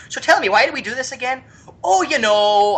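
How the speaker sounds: high-pitched, rough attempt at a Canadian accent; badly faked Canadian accent. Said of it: "I can't do a Canadian accent".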